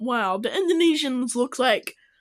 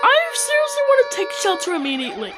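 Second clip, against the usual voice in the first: mock panic; 10 semitones higher, much faster than usual. Real, not acted. Mocking the tsunami warning.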